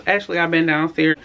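ordinary talk

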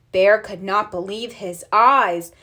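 ordinary delivery, nothing marked

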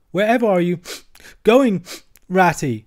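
the frightened Mole crying after his friend; tearful alarmed cry. A narrator's reading. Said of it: "cried the tearful Mole".

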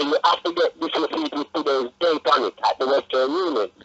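ordinary delivery

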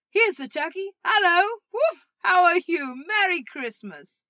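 high, very loud whooping welcome; jubilant greeting. Acted. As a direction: hail them with giddy delight. Scrooge joyfully greeting the turkey.

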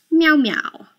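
high whispered meow; tiny whispered kitty meows; cat meow. Pretend. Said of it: "Say hello to the cat".

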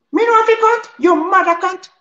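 high, loud furious Jamaican patois retort. Acted. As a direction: snap back in Jamaican patois. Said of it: "il parle que jamaïcain". (réplique furieuse en patois jamaïcain, aiguë)

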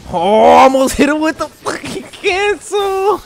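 slow, high frustrated yell; excited yell. Real, not acted. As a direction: shout at the near miss. Near miss in match.

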